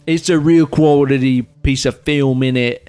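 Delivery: mock British accent. Podcaster praises the film in a mock British accent. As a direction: review it in put-on British slang. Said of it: "Our British accents".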